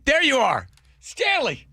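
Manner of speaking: high, shouted cry of joyful surprise; Oh my God, it's really you; overjoyed shout. Real, not acted. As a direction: shout it out as you recognize him. Surprise reunion with old friend.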